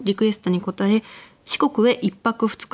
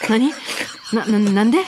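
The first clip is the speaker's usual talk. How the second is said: panicked rapid-fire babbling; flustered, stammering and fast; too much caffeine. Acted. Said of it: "半ばパニックになりながらも、おばさんを早口でまくし立てました".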